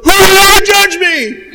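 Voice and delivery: loud, very high outraged yell; hammed-up shriek of offense; indignant scream. Warned person lashing back at pastor, hammed-up indignant scream.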